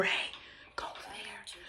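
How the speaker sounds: soft unvoiced whisper; small inner voice. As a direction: whisper it like a quiet conscience. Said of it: "feelings is that small voice".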